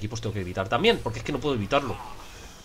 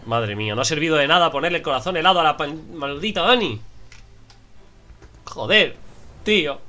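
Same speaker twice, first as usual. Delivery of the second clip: annoyed outburst; 5 semitones higher, 5 dB louder than usual. Real, not acted. Annoyed at failed item.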